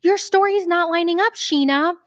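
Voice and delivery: a hint of a pushy mother; lightly voiced scolding; mock accusing Molly. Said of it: "And so Molly goes".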